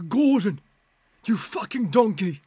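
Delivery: loud, high screaming chef rant; hammed-up Gordon Ramsay yell. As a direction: bellow at him like a furious TV chef. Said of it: "Gordon Ramsay".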